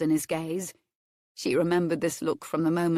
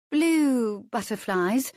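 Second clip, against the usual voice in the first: slow, drawn-out teasing guess; lingering on blue with a rise; coy and shy; 6 semitones higher, 4 dB louder, much slower than usual. Acted. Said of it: "shyly nibbled the tip of her little finger for effect".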